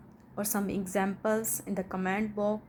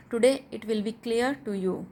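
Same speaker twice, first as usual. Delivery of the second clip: normal talking voice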